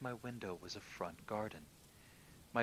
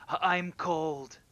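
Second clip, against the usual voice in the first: high, slow, playful ghostly whine; jokey ghost voice; 8 semitones higher, 12 dB louder, slower than usual. Retold or imitated. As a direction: tease like a ghost who is cold. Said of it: "in kind of a jokey way".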